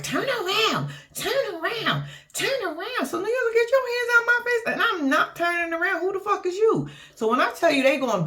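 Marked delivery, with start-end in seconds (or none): 0.0-3.1 mock insistent command. Rain calling in the van. 3.5-6.9 mock indignant snap. New York's heated retort.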